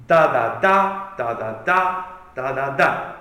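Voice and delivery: "da, da, da" with the third "da" hit hard; rhythmic da-da-DA chant; anapestic rhythm. Shown as a demonstration. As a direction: chant "da, da, da" stressing the last beat. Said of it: "three syllables with the emphasis on the third".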